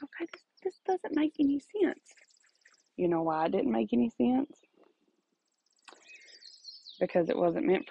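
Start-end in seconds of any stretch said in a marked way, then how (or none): 0.0-2.1 baffled. Retold or imitated. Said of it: "and I'm like".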